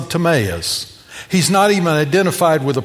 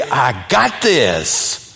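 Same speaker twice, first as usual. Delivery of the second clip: very slow lower cocky line; parodying a guy's famous last words, slow; mock guy bravado; 5 semitones lower, much slower than usual. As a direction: say it like the guy about to fail. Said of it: "guys say".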